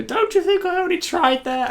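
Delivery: high-pitched mock-frantic yelp; panicked movie character. Someone in the trash compactor answers back, mock-frantic high voice. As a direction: squeal it as a hammed-up film line. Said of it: "And then someone will say".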